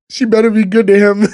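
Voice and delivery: a hint of a big guy getting emotional; [name]'s protective line. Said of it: "who's like".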